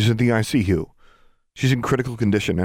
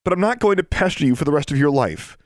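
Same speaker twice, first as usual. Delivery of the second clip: high, loud, impassioned begging; desperate pleading; 9 semitones higher, 4 dB louder than usual. Acted. Girl begging her estranged sister to save a friend, desperate pleading. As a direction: plead urgently, pitched high and pushed hard.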